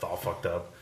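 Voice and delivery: ordinary talk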